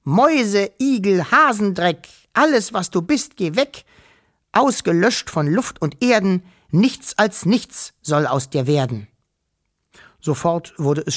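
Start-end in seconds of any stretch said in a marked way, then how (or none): 0.0-9.1 high, declaimed magic spell; reciting aloud. A narrator's reading. Wischel reading the spell aloud at the monster, high declaiming voice. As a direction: chant the spell out in a raised voice. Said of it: "las ihn mit lauter Stimme vor".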